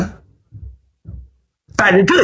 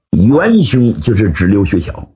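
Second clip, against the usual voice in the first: ordinary delivery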